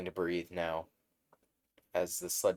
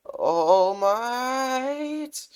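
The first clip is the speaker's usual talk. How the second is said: much higher, louder yell of his name; cling and yell; desperate shout; 10 dB louder than usual. Acted. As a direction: kid clinging to the hero's leg. Deku grabs leaping hero.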